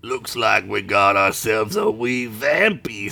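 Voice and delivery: slow, low goblin voice; leering goblin drawl, pitched low; goblin Slit. A narrator's reading. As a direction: savour it slowly as a goblin eyeing prey. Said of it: "Slit said, licking his lips".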